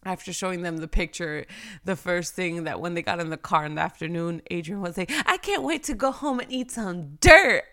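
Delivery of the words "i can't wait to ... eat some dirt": put-on higher, louder eager child voice; excited kid. Son after school, eager to go home and eat dirt. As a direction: burst out like a thrilled little boy.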